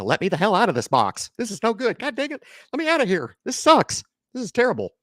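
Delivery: high, hammed-up frantic complaint; mock yelling. Pretending to scream from inside the box, mock high. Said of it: "I'm screaming and yelling".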